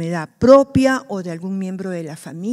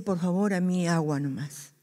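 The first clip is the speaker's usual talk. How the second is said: softer, lower, modest request; soft polite refusal; 3 semitones lower, 9 dB quieter than usual. Retold or imitated. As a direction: decline the drink quietly. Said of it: "este hermano dice".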